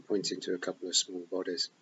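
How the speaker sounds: plain reading voice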